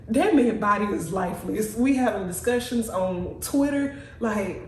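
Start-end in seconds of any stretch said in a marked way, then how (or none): none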